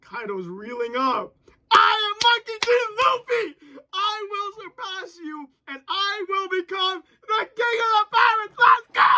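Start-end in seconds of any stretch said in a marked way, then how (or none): 1.7-8.6 hyped yelling. Real, not acted. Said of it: "I said, "Luffy ... says". 8.6-9.0 hyped yelling. Real, not acted. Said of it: "I said, "Luffy ... says".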